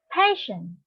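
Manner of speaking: "passion" with the first syllable stressed. Shown as a demonstration. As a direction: say "passion" as PA-ssion, falling at the end. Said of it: "stress on the first syllable".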